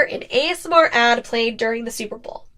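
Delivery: normal talking voice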